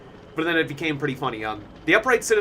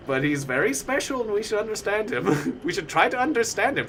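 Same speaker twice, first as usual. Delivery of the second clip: mock doctor pleading for understanding, higher-pitched; earnest doctor; 6 semitones higher than usual. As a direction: plead the patient's case, imitated. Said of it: "even the doctor".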